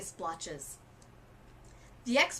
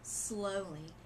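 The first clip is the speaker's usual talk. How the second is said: usual speaking voice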